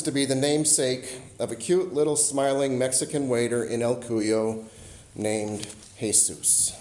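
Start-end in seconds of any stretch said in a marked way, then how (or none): 5.1-6.7 "Jesus" said hay-SOOS, slow and soft; Spanish pronunciation. Shown as a demonstration. Preacher's closing line, "Jesus" said hay-SOOS, slow and soft. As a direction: let "Jesus" land as hay-SOOS, slowly and quietly. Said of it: "Spanish for Jesus".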